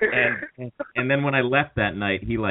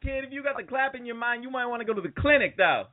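quick Southern twang joke; Southern accent; 12 semitones higher, faster than usual. Acted. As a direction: tease the listener in a Southern accent. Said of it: "I love the accent".

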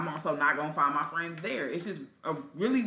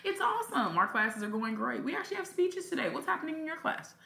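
bright, higher polite office voice; professional small-talk voice; 5 semitones higher than usual. Retold or imitated. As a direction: put on the polished colleague voice. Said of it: "I sound like you".

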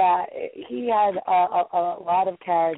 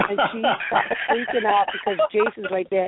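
usual speaking voice

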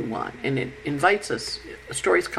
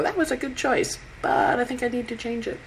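higher-pitched musing aloud; voiced inner thought; 7 semitones higher, 4 dB quieter than usual. Someone weighing up their own choices, higher-pitched musing. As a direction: think it over aloud, raised pitch. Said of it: "look at the choices you've made and go".

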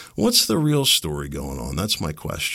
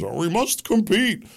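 much higher, slow, snooty mock announcement; mock pompous broadcaster; 14 semitones higher, 4 dB louder than usual. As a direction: parody a grand public radio boast, drawn out. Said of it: "was touted as".

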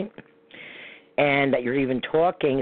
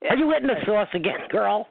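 playful mock accusation, pitched higher; teasing; 8 semitones higher than usual. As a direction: tease your co-host like she's tipsy. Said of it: "No, I'm kidding".

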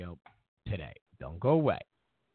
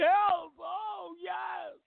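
higher, hammed-up swooning fan voice, with shouts; mocking a TV host swooning over the president, much higher; Rachel Maddow mockery; 9 semitones higher than usual. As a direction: gush over him like a starstruck groupie. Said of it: "Rachel Maddow, she".

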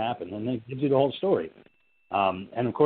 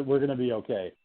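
ordinary talk, nothing marked

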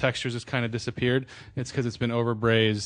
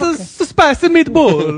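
loud, high cartoon Italian accent; 13 dB louder than usual. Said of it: "could you do this in a nice Italian voice".

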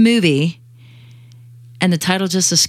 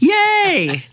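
excited; 10 semitones higher than usual. Real, not acted. Cheering after being complimented.

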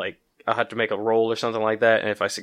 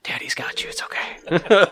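put-on soothing a child; 7 semitones higher, much slower than usual. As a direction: hush them like a crying child. Soothing the hit party member.

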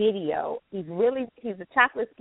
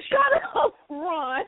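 high, laughing protest at being teased; laughing retort; 9 semitones higher, slower than usual. Real, not acted. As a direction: tell him off through laughter. Teased about her accent, laughing retort.

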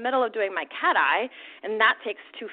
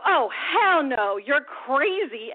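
retold livid outburst, slow and drawn out; higher-pitched, stretched-out indignant refusal; 4 semitones higher, much slower than usual. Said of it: "because I'm livid, I'm seeing red".

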